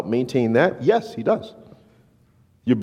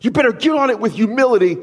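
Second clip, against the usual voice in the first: mock stern scolding; 9 semitones higher, faster than usual. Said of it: "yell at you and say".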